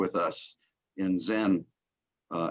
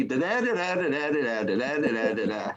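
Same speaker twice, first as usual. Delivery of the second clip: sing-song verse meter; 2 semitones higher than usual. Shown as a demonstration. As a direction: chant a mechanical poem beat in nonsense syllables. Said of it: "poems don't have to be".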